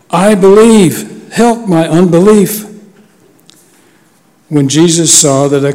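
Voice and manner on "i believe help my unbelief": raised, higher-pitched plea, first words drawn out; understated outcry in a scripture reading; crying out. A narrator's reading. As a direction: cry out like a pleading father, reading aloud. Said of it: "the father of the child cried out".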